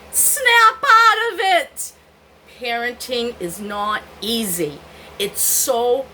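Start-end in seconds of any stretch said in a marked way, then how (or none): 0.1-1.9 very high, loud, slow and pressed; urgent plea. Real, not acted. Mother calling to young listeners, loud slow plea. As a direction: shake the listener awake. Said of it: "urging young listeners".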